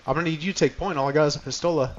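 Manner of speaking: usual speaking voice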